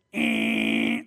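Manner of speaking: long low droning buzz; flat, held mechanical drone; dryer buzzer. Acted. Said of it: "the buzzer of the dryer".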